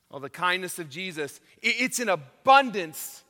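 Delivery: ordinary delivery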